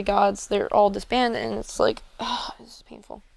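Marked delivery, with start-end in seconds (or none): 2.2-3.2 softer and slow, barely voiced; anxious whisper. Burdened god tells friend it's painful, whispered. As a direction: let the pain slip out in a whisper. Distraught character after emotional outburst.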